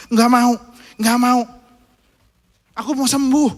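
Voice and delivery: put-on a stubborn kid wailing no; whiny child refusal. Son wails at papi that he won't get up, loud and high.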